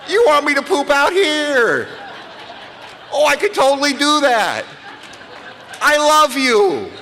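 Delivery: very high, loud, slow gushing puppy voice; the thrilled puppy learning to poop outside; overjoyed puppy. Retold or imitated. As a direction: squeal with puppy delight. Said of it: "He'll go".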